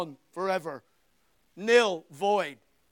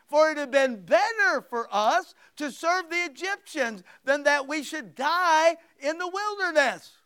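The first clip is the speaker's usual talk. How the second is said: whiny complaint; 6 semitones higher, 7 dB louder than usual. Acted. Israelites complaining to Moses.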